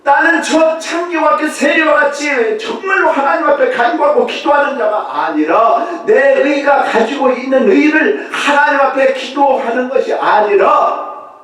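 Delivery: loud, high, insistent preaching; full-force preaching. Acted. Preacher to congregation about Pharisees, fervent. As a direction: hammer each phrase.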